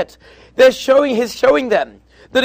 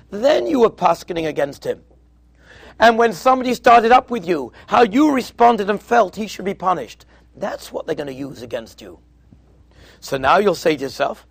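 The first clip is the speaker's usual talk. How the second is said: normal talking voice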